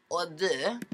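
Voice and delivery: soft mock French accent; playful French-accented purr; sexy French accent. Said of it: "I wanna sound French and sexy".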